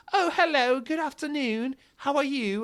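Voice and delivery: high, posh-ish mock British greeting; mock British accent, sung up high; British neighbor. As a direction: imitate a chirpy English lady. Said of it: "we have a British neighbor".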